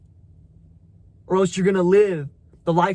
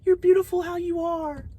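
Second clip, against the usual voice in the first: mock sweet reassurance; 11 semitones higher than usual. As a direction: parody the people coddling a fat friend. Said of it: "everyone's like".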